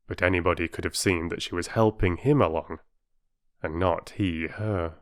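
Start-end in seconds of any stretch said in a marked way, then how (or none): none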